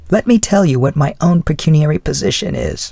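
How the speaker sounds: a hint of the old widow's voice; elderly lady voice. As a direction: speak as a kindly older woman. Mrs Callender explains.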